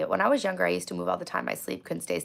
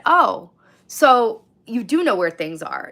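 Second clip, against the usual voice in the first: slow, louder gotcha; slow accusing gotcha; 4 semitones higher, 7 dB louder, slower than usual. Retold or imitated. Confronting the worker who claimed ignorance, slow gotcha. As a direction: drag it out, catching her lying. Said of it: "I said".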